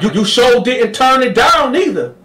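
high, loud retort; high snide comeback; Sweet Lo. Retold or imitated. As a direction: throw it back at him. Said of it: "Sweet Lo was like".

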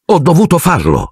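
mildly irked retort, pitched high; annoyed. A narrator's reading. Minister rebutting his critics, mildly annoyed. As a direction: defend yourself with a touch of annoyance.

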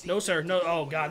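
normal talking voice

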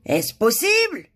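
high, slow, loud disbelief; crying out. A narrator's reading. Shocked king hears of a smile, crying out. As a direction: cry out, astounded. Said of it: "le roi s'écria".

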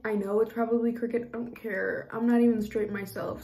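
ordinary talk, nothing marked

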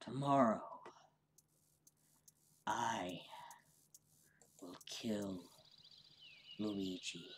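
quiet whispered menace; unhinged villain. Acted. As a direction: plot murder in a whisper.

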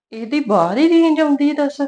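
loud, high scornful jab; mother-in-law mocking the bride's excuse, loud and high; mocking outburst. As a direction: spit out ਬਾਹਰ ਹੀ range with loud, high scorn. Mother-in-law grumbling, mocking daughter-in-law.

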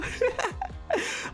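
giggle. Real, not acted. Laughs at photo.

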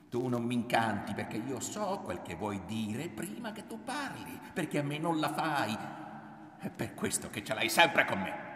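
proud, heated retort; drunk with pride. A narrator's reading. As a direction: crow that you see right through him. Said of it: "Sembrava ubriaca d'orgoglio".

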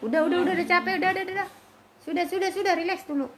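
high, rapid-fire repeated pleas; urgent calming. Real, not acted. Breaking up fighting pets. (melerai cepat bernada tinggi)

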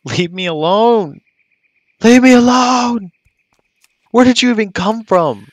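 high, loud frantic squeal; panicked squeaky voice. Pretend. Chased by mob again.

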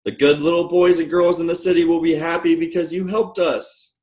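high, happy storybook voice; pleased, high-pitched toy cheer; grateful toys. A narrator's reading. Toys happily thank the engine.

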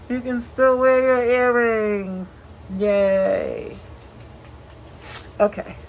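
muffled behind cloth mask; speaking with mouth covered; through a face mask. Real, not acted. Seen 1: Valved cloth mask covers mouth and nose throughout; removed after 7.9.

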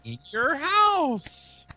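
high, very drawn-out cartoon catchphrase; Master Shake. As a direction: stretch each word in a high mock-cartoon voice. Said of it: "it's Master Shake saying".

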